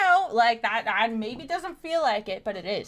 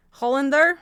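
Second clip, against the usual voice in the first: higher, louder, questioning Russian accent; 4 semitones higher, 7 dB louder than usual. Shown as a demonstration. Streamer practicing a character's line, questioning Russian accent. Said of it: "practicing my Russian accent".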